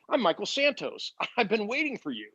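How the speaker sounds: a mentor glad he has arrived; imitating Michael Santos. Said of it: "He goes".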